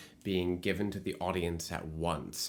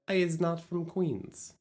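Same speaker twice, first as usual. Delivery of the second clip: higher, hammed-up Queens accent; 6 semitones higher than usual. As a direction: joke in a mock Queens accent. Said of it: "I don't know why I'm doing this accent".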